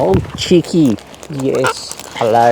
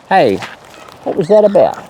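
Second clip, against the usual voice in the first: normal talking voice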